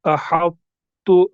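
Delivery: very slow "अहत्तु", heavy t held before a light "tu"; drawn-out recited "अहत्तु", heavy then light t; both letters sounded. Shown as a demonstration. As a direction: say "अहत्तु" as "ahaṭ", stop, then "tu". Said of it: "हम पढ़ेंगे इस तरह".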